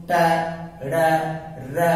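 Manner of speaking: ट, ड़, र each said alone with the tongue curled back; tongue-curled letter demo. Shown as a demonstration. Teacher demonstrating retroflex ट, ड़, र to students. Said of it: "जैसे बोलो".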